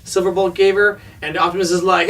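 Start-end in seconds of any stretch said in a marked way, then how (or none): none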